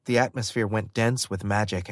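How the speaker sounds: usual speaking voice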